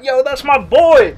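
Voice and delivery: loud, slow, proud cheer; mock slow-motion cheering. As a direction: hype up your friend. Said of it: "it's just like".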